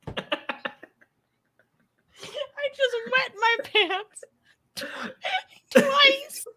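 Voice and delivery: very high, squeaky, squeezed out through laughter; helpless laughter. Real, not acted. Host retrying a viewer's comment, helpless with laughter. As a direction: struggle to get it out while cracking up.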